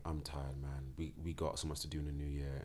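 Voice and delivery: tired and very soft, letting it go; weary. Retold or imitated. Telling himself to drop the ban, worn out and soft. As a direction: sigh it out, done with the fight.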